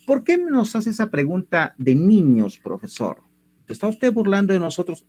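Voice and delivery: mildly indignant pupil, hammed up a little; mock student protest. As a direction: play a student objecting to the teacher. Voices an imagined student's protest. (protesta fingida de alumno ofendido)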